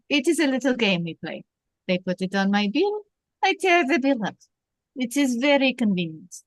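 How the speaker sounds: put-on lightly French-accented police captain. Captain explains his little game with the bill, French accent. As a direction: play Renault with a French accent. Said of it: "I think you do the accent".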